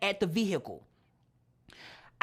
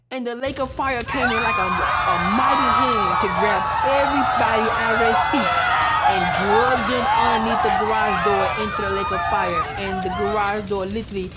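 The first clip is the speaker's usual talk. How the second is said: much louder, full force; thundering preaching; 9 dB louder, faster than usual. Real, not acted. Climax of the vision.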